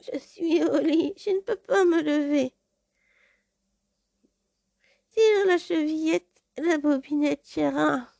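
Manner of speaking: put-on the wolf squeaks like a little girl; tiny faked voice. Wolf fakes the granddaughter's voice at the door, tiny and high. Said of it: "prendre une voix toute petite voix".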